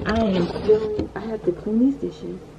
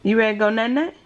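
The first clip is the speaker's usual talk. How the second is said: coaxing sing-song; 9 semitones higher, 4 dB louder, slower than usual. Real, not acted. Coaxing toddler to sleep.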